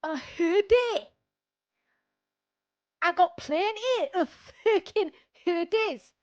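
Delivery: hammed-up Scottish shout about hoodies; Scottish accent. Putting on a Scottish accent while ranting about hoodies. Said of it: "Why am I Scottish now?".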